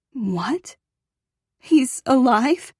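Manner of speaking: high, slow, breathless disbelief; can't believe he's alive; stunned disbelief. A narrator's reading.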